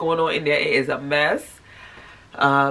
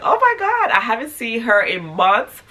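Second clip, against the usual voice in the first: excited; 6 semitones higher than usual. Retold or imitated. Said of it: "I was like".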